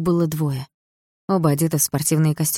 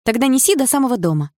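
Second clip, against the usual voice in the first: demanding; 8 semitones higher, 4 dB louder than usual. Acted. Girl demands he carry her.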